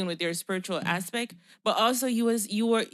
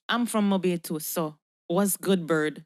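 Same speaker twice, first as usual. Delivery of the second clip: Jamaican speaker sounding Yankee; Yankee accent; slower than usual. Shown as a demonstration. Claiming Mobay roots to Bird, Yankee accent. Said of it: "talk like a Yankee".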